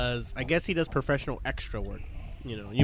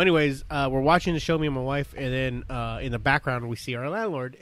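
ordinary talk, nothing marked

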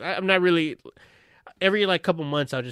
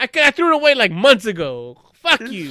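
mock angry retort; 5 semitones higher, 7 dB louder than usual. Acted quarrel continues.

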